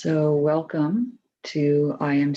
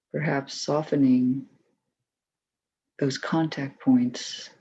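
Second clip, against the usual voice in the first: calm, even voice with long pauses; unhurried, settled meditation leader's delivery; guided meditation. Acted. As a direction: guide them gently through the body, leaving space between phrases.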